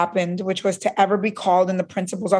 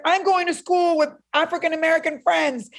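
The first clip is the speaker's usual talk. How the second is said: put-on defiant teen; 7 semitones higher than usual. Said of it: "I said".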